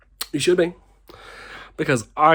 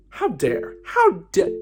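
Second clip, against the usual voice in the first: tipsy indignation; 13 semitones higher, slower than usual. Real, not acted. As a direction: loose tipsy how dare with laughter. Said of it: "I don't actually talk like this".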